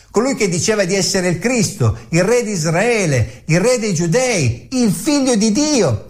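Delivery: mock him as a false king; mocking taunt. Preacher voicing the leaders who jeer at Jesus, sneering.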